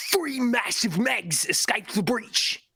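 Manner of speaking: slow, hammed-up British accent; mock British accent. Reading what the trailer reveals, slow mock British accent. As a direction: draw it out in a put-on British accent.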